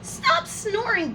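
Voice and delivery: plain reading voice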